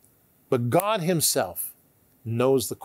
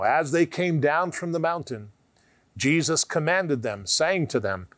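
ordinary talk

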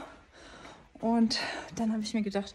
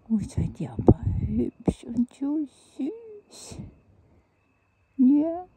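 cooing; 4 semitones higher, 8 dB louder, slower than usual. Real, not acted. Woman telling the kittens they are pretty, cooing. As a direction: coo with drawn-out delight.

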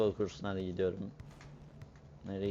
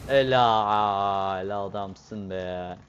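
drawn-out praise; 7 dB louder, much slower than usual. Real, not acted. Praising teammate's play.

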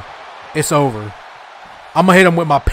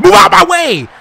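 high, forceful holler; exasperated yell; 10 semitones higher, 3 dB louder than usual. Real, not acted. Player ordering the ref aside, exasperated. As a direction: yell the order with pitch shooting up. Same order yelled higher.

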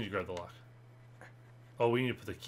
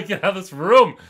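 louder yell through laughter; louder, higher laughing shout; 8 semitones higher, 17 dB louder than usual. Real, not acted. Laughing while fleeing.

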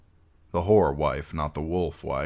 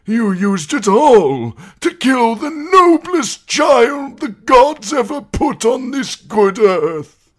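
high, loud, slow quavering shout; a frail old man crying out in outrage; old maester denouncing the imp before the judges; accusing old maester; 11 dB louder, slower than usual. A narrator's reading. Pycelle accuses Tyrion.

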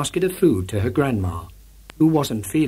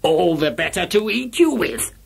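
wolf growl; 5 semitones higher than usual. A narrator's reading. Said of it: "the wolf growled".

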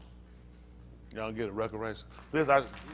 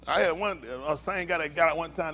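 ordinary talk

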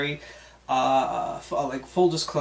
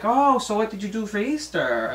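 light, higher prying lunch lady voice; imitating the lunch lady; 8 semitones higher, 3 dB louder than usual. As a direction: play the nosy coworker probing, pitched up. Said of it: "she was like".

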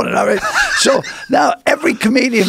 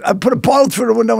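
normal talking voice

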